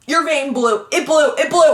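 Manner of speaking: high, loud imitation of a panicking nurse; mock freak-out. Nurse reacting to a blown vein, mock panicked. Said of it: "she just starts freaking out".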